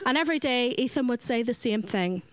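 ordinary talk

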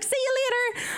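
very high-pitched, silly farewell; squeaky, exaggerated put-on voice; weirdo voice. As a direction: imitate yourself sounding like a weirdo. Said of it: "you sound like such a weirdo".

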